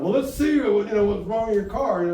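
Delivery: mock flamboyant guy. Stranger offering to fix stalled car, high camp mock voice. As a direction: ham up a flamboyant helper. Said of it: "and he said".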